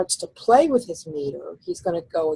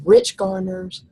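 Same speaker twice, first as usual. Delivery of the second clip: rich garners louder and stressed; stressed words; 5 dB louder, slower than usual. Shown as a demonstration. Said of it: "words that he wants to accent".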